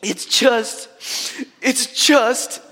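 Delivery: high, halting mock-crying voice; haltingly tearful, pitched high; imitating tearful sobbing. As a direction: act out a boy too choked up to finish. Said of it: "he's crying and crying".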